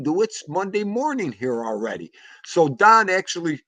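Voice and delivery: usual speaking voice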